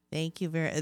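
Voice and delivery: ordinary talk, nothing marked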